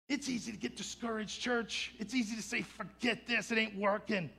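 high, loud, emphatic sermon voice; forceful preaching. Real, not acted. Preacher warning church about discouragement, forceful preaching.

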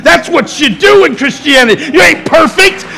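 shouting sermon, voice high and loud; preaching at full force. Acted. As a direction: preach it out, rising to a roar. Preaching climax.

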